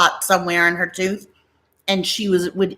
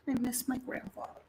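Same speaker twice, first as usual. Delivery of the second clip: wistful; 5 semitones higher, 18 dB quieter than usual. Real, not acted. Sighs missing late grandfather.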